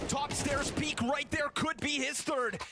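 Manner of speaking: fast, high, building caster call; hype play-by-play. Acted. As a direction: call the clutch with rising hype. Live play-by-play rising.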